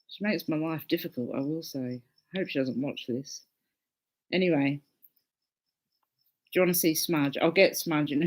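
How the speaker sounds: ordinary talk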